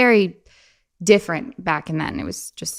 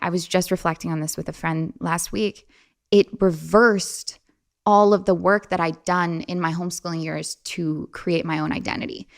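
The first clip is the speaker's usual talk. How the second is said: usual speaking voice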